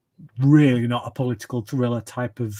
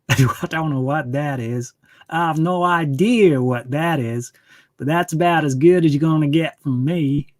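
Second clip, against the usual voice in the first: a lightly put-on American Southern drawl, half laughing; 3 semitones higher, 5 dB louder than usual. A viewer asks for his best American accent, so a joking Southern drawl. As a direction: ham up a bad American Southern drawl like sounding drunk. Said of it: "some kind of southern drawl".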